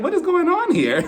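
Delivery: baffled squeal; shocked high. Retold or imitated. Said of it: "and I'm like".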